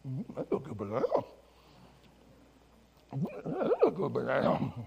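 mumbled through pretend chewing; mock mouth full. Pastor eating banana before the kids, mouth full. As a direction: savour it, words muffled by food.

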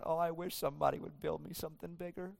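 hint of a sulky sigh of a wish; mock whining. Preacher mocking a God who sulks about his tent, softly. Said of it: "God's not out in the tabernacle going".

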